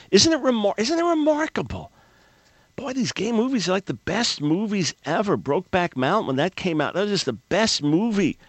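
high-pitched, hammed-up amazement; mock gushing. Host mocking media praise for these movies, mock gushing. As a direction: imitate a gushing admirer, pitch leaping high.

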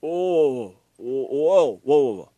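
loud, startled whoa, whoa; shocked whoa. Real, not acted. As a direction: stop everything, you can't believe what you heard. Host reacts to shocking clip.

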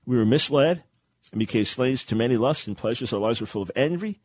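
normal talking voice

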